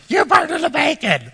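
high, drawn-out shriek of outrage; mock family yell. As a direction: imitate an angry family yelling, hammed up. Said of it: "my family's yelling at me".

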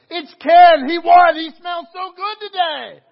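high, loud triumphant squeal; excited shout. Retold or imitated. Waitresses announcing the winner, shrieking high. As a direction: announce the winner like giddy girls. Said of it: "and they go".

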